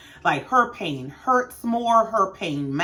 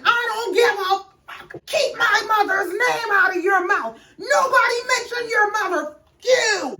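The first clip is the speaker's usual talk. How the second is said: much higher, shrill-free hammed-up shouting; overlapping imitated yells, pitched high; mock screaming match; 13 semitones higher than usual. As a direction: scream it like a messy table fight. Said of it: "them screaming".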